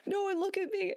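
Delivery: high, strained voice for the sick sergeant; panicked plea. Retold or imitated. Friend voiced squatting in desert.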